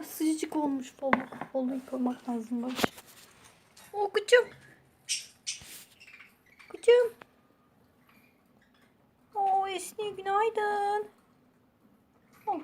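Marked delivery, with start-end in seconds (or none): 3.9-12.6 much higher cooing greeting. Real, not acted. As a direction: sing it up high to the bird. High baby talk greeting bird.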